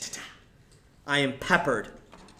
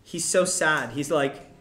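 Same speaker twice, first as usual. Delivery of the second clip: ordinary talk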